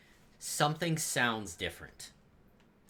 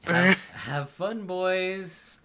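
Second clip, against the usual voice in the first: higher, louder, slow and smug; dismissive NPC voice; 8 semitones higher, 9 dB louder, much slower than usual. Acted. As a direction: wave them off, bored and condescending.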